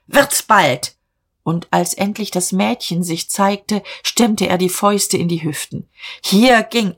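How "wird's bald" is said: moderately raised, impatient order; exasperated command, slightly lifted voice; impatient shout. A narrator's reading. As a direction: hurry them up with a mild yell. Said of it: "schrie Diederich".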